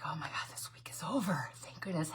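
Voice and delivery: a whispered sigh of relief; relieved whisper. Retold or imitated. Host telling viewers about her work week, whispering her relief. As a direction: breathe it out in a whisper, week finally done.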